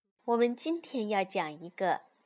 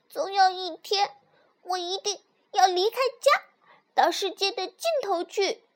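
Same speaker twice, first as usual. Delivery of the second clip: high, loud little fox voice; loud declaration; 7 semitones higher, 5 dB louder than usual. A narrator's reading. Little fox announces a plan to leave home, loud declaration. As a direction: proclaim it in a high child-like fox voice. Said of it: "小狐狸大声说".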